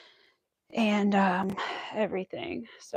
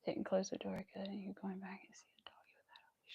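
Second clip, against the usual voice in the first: whisper; 21 dB quieter than usual. Real, not acted. Whispering while watching.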